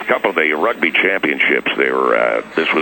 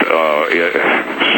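ordinary talk, nothing marked

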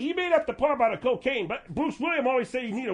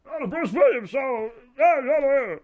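Bruce Williams impression; 5 dB louder, slower than usual. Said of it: "pretend like I'm Bruce Williams".

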